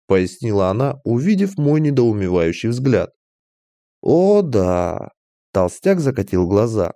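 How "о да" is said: fawning shopkeeper's drawn-out delight; oily fat merchant, gushing. A narrator's reading. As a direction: gush like a sly merchant. (льстивый торговец, восторженно)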